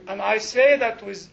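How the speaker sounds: emphatic, slowing down firmly; absolute assurance. Real, not acted. Preacher assuring the congregation, emphatic and sure. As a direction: say it with weight and full assurance. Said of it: "absolute assurance".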